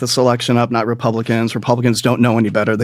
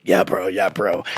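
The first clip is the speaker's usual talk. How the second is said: soft low bro; 7 semitones lower, 6 dB quieter than usual. Retold or imitated. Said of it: "and he's like".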